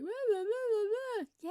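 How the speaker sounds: very high, loud babbling whine; imitated whiny child. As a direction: mimic a whining kid in squealed nonsense. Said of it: "kids don't even realize that they're whining".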